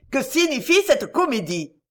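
high-pitched, indignant narrated outburst; outraged cry. A narrator's reading. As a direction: cry it out, beside yourself. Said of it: "cria Joachim Escan hors de lui".

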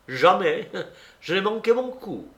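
high, emphatic self-praise; proud boast. Acted. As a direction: declare your perfect record triumphantly. Boasting answer to her question. (vantardise triomphante)

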